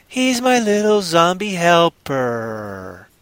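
high, cooing, drawn-out ending; mock-adoring singsong, slow and high; doting zombie owner. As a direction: show off your pet helper sweetly. Voices a fond zombie owner.

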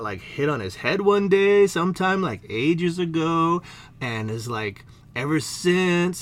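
ordinary talk

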